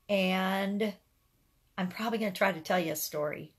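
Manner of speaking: ordinary talk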